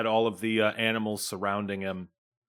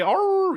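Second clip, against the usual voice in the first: wolf growl; 6 dB louder than usual. Acted. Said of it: "I kinda go my own way".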